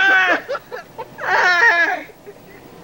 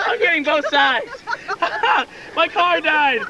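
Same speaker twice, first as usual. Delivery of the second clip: ordinary talk, nothing marked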